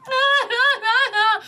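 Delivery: high-pitched mock wailing; fake crying. Imitating Victoria's fake crying for her co-hosts, mock wail. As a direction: put on exaggerated, high fake sobs. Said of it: "crying, she's like".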